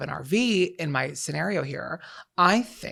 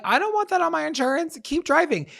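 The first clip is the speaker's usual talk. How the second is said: high-pitched, hurried urging; retold panic; 11 semitones higher than usual. Passenger retells urging driver to flee the scene, panicked. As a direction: blurt it out high and fast. Retelling own freak-out over mirror.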